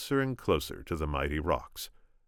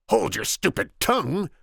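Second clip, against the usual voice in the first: higher, sharp order; commanding; 11 semitones higher, 4 dB louder than usual. Acted. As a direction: shut him down hard. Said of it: "the Sicilian commanded".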